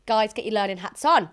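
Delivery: ordinary talk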